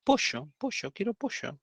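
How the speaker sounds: each POLLO as high-pitched "posho"; porteño pollo. Retold or imitated. Porteña insisting on her order to the waiter, pollo as "posho". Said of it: "media porteñada".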